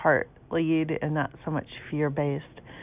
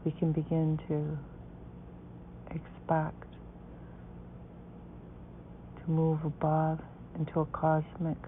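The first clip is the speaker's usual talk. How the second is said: guided meditation; 9 dB quieter than usual. Acted.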